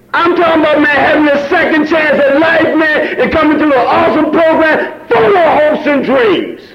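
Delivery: high, loud shouted testimony, voice cracking; rising fervor of a meeting testimony; preaching at full force. Real, not acted. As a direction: preach it to the room, near tears.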